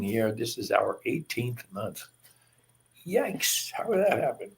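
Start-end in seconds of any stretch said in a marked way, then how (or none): 3.1-4.5 high-pitched startled yikes; mock alarm. Real, not acted. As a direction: pitch leaps up in playful disbelief. Amazed at how time passed.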